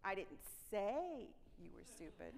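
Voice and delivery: "say" lifted and stressed, then falling; weight on "say" in "I didn't say". Shown as a demonstration. Trainer's third take, with "say" stressed. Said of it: "how this is gonna go".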